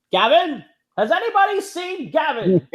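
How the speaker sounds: high, loud mock call; movie quote. Host quoting a Western scene, high mock calling. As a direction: call out for Gavin in a hammed-up voice.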